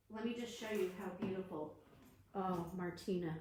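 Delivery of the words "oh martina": ordinary delivery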